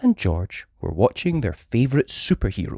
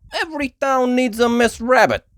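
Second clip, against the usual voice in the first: high, loud New York voice; wisecracking driver; New York cabbie; 13 semitones higher, 4 dB louder than usual. Acted.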